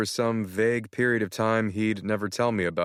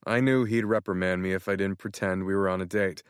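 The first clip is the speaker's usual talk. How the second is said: plain reading voice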